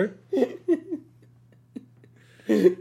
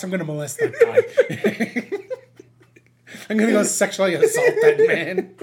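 laughing; 13 semitones higher, 6 dB louder than usual. Acted. Escalating joke quote, laughing.

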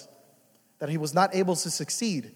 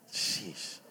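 whispered sheesh; 11 semitones lower, 12 dB quieter than usual. Acted. Mock exasperation.

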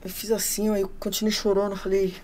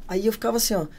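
normal talking voice

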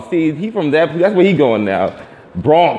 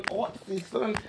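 Artsy echoed higher and soft; mocking repeat; 11 semitones higher, 13 dB quieter, slower than usual. Shown as a demonstration. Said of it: "This is artsy".

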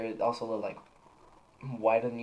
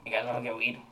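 gruff voice; faster than usual. Retold or imitated. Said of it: "talked in, like, a more gruff voice".

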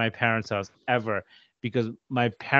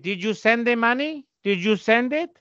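much higher, nagging mother; imitating mom; 10 semitones higher than usual. Mother nagging her son about the money, voiced higher. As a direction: pester like an anxious mom. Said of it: "she's like".

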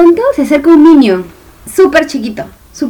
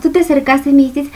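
normal talking voice

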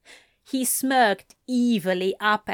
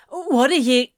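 startled, raised protest; complaining; 4 semitones higher, 5 dB louder, faster than usual. A narrator's reading. Anthony protesting as Crowley turns the tables, startled complaint. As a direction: protest in surprise, up and loud. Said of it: "Anthony complained".

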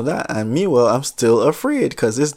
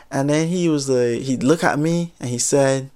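normal talking voice

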